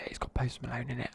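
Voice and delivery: usual speaking voice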